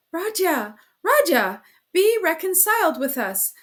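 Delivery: high, loud pleading cry. A narrator's reading. Mother pleading with departing son, high and loud. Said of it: "cried his poor mother".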